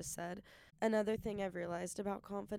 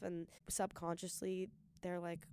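normal talking voice

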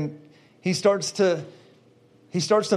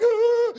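high cry; 3 dB louder than usual. Retold or imitated. Overwhelmed cry.

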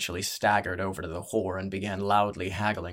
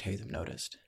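ordinary delivery

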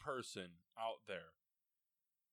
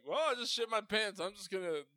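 loud mock announcement; 11 semitones higher, 9 dB louder than usual. Acted resigned quote.

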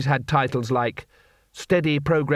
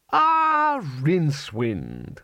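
put-on booming old wizard, drawn out; lightly voiced hearty headmaster; Arch-Chancellor voice; 3 semitones higher, much slower than usual. A narrator's reading. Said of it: "said the Arch-Chancellor".

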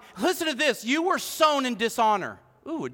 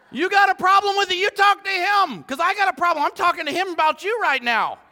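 mock scolding dad; 8 semitones higher, 5 dB louder than usual. Mock scolding his kids.

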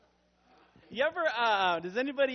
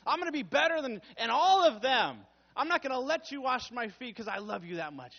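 1 over-eager Peter; 5 semitones higher, 4 dB louder than usual. Retold or imitated. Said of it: "and Peter's like".

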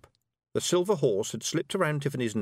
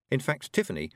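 usual speaking voice

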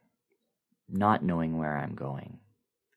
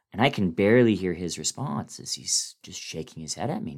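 ordinary delivery, nothing marked

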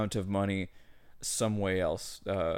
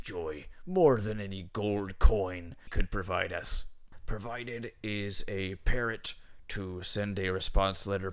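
put-on pirate accent; 4 dB louder than usual. Pirate captain thanking the brothers in his letter, pirate accent. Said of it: "it's a pirate accent".

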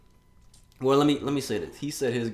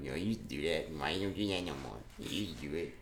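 lower, softer imitation of girlfriend; mock girlfriend voice; 5 semitones lower, 9 dB quieter, faster than usual. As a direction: lightly mimic a complaining girlfriend. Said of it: "She don't even talk like that".

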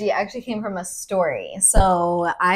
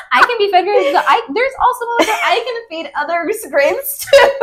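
high, loud laughing speech; 14 semitones higher, 7 dB louder than usual. Real, not acted. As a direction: giggle through the words.

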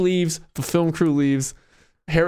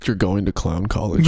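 very low magician-parent deadpan; Blaine's mom; 11 semitones lower than usual. Acted. Parents threatening their son, very low deadpan. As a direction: drop it right down, ominous scolding. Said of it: "then they talk like that too".

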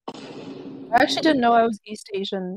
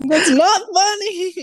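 laughing protest; 9 semitones higher, 4 dB louder, slower than usual. Pretend. Fake outrage over joke.

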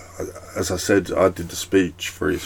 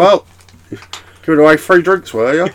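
sarcastic accusing voice, raised; mock lawyer, higher and louder; mock accuser; 8 semitones higher, 5 dB louder than usual. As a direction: play the suspicious lawyer catching him out. Mock legal heads accusing.

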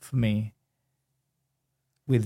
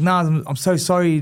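flustered apology; 6 semitones higher, 3 dB louder than usual. Retold or imitated.

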